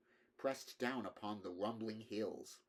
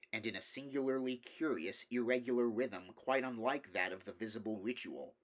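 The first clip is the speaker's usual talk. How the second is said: ordinary delivery, nothing marked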